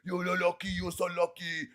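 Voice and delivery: lightly voiced tough guy stammering a threat; a hint of a sputtering bully; mock threat. Mocking Ferrigno's threat, nose to nose, light imitation. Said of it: "he's like".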